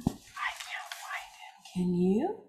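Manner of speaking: put-on hushed secret whisper to the kids. Teacher whispering to children at circle time, hushed whisper. Hiding seahorse, shared whisper.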